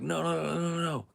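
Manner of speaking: playful panicked pleading; mock pleading. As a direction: beg him not to stand up. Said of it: "and we'd be like".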